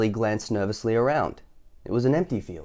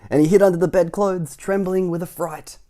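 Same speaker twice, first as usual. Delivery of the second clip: story narration; 8 semitones higher, 6 dB louder than usual. A narrator's reading. Reading to a child about Mister Jelly hiding. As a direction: draw out the trembling.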